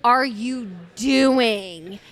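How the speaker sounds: loud, very slow, each word weighted; exasperated demand. Retold or imitated. Woken woman retells confronting her partner, exasperated. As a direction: stretch it out in mock outrage.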